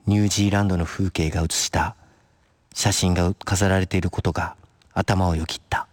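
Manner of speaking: low, measured literary narration; story narration. A narrator's reading. Narrator telling listeners what crosses her mind, composed story narration.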